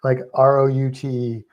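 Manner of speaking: normal talking voice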